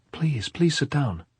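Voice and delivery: lightly acted girl's entreaty; pleading. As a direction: beg her gently, stretching the words. Maria begs Pilar to sit.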